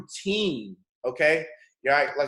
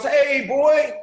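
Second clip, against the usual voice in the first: high, loud, carefree retold hail; breezy greeting; 13 semitones higher, 10 dB louder than usual. Father greeting his crying son on the street, breezy. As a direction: holler back as if nothing is wrong. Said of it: "Like, nothing's wrong".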